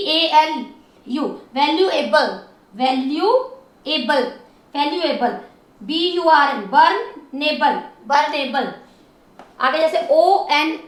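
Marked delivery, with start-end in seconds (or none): 2.7-4.2 valuable slowly, as value and एबल; drawn-out, segmented valuable; syllable by syllable. Shown as a demonstration. Teacher splits word into syllables. 6.7-7.8 बर्नेबल slowly, as burn and एबल; drawn-out, segmented बर्नेबल; syllable by syllable. Shown as a demonstration. Teacher splits word into syllables.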